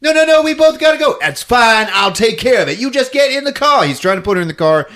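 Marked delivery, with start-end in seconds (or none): 0.0-1.2 high, loud, fast plea; hammed-up panic, words tumbling out; mock frantic protest. 1.2-3.8 high, loud orders; hammed-up hero taking charge; mock urgent insistence. Rescuer insists loudly.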